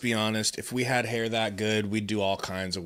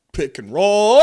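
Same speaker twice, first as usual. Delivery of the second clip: loud, high shout on the play; live basketball shout; excited play call; 9 semitones higher, 10 dB louder than usual. Real, not acted. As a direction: yell it out, stretching the last word high. Calling the play as it happens.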